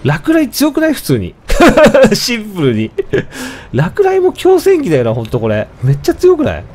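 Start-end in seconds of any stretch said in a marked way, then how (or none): 1.4-2.1 loud, high burst of laughter. Real, not acted. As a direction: laugh out in delight. Laughing at strong lightning spell. (高く大きな笑い)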